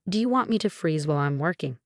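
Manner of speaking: usual speaking voice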